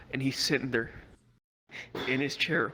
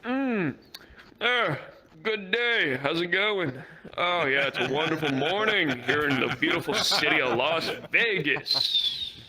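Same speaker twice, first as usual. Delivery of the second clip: fake-polite sober act; 3 semitones higher, slower than usual. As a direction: put on a squeaky-clean innocent act. Friends fake sober for cops.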